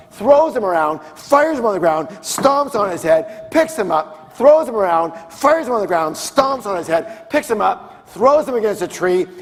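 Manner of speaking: emphatic action storytelling. Acted. Narrating the elephant's violent thrashing.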